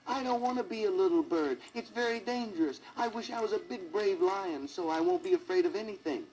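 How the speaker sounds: high scared cry for help; frightened yell. A narrator's reading. Little bird Sidney yelling to fairy godmother, scared. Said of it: "Sidney yelled".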